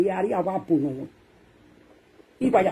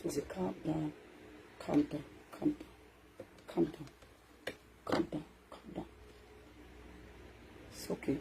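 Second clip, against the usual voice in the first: much softer, murmured calm down; self-calming murmur; 6 semitones lower, 19 dB quieter than usual. Acted. Calming himself before the crowd, soft murmur. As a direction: talk yourself down quietly. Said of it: "I cool my temper".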